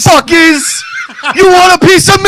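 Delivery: put-on very high shouted Cuban gangster accent; Tony Montana voice. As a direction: scream it like Scarface on the balcony. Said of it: "like Tony Montana".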